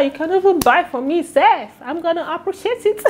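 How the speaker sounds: high-pitched cheeky plea to buy her something; playful high tease. Pretend. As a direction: tease the viewers, fishing for a gift. Jokingly begs viewers to buy her gifts.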